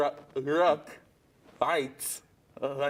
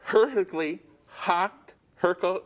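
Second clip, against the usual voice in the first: normal talking voice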